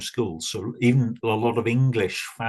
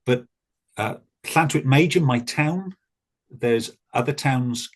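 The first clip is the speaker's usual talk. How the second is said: usual speaking voice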